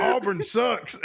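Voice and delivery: high, hammed-up yokel jeer. Mocking Southern redneck voice.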